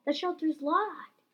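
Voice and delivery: high, drawn-out yell. A narrator's reading. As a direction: cry out the bad news slowly. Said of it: "yelled Caddy".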